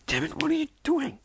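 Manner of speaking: very high, put-on squawk; mock outrage jumping up in pitch; exasperated. Shigaraki seeing his Nomu petted, high exasperated outburst.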